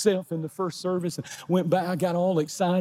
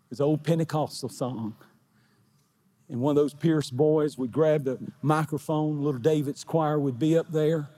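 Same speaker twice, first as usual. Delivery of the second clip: ordinary talk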